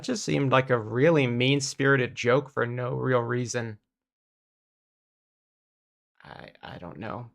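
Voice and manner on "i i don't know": very soft trailing mumble; under your breath, at a loss; quiet mumble. Real, not acted. Stunned reaction after bad joke.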